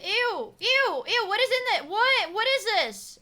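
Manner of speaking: high, repeated disgusted exclamations; grossed-out squeal. Real, not acted. Streamer asking what is inside, grossed-out squeal. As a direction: recoil from it with high-pitched ews.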